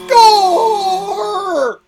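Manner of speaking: high, loud whoop of triumph; excited yell. Real, not acted. Celebrating that the group agrees, a high triumphant yell. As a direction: shout it like a goal. Cheering agreement on western games.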